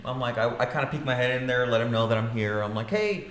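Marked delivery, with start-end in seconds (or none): 2.9-3.3 high, overly polite and sweet; mock-polite request to the rude realtor. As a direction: ask ever so nicely, pitched way up. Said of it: "Just being polite".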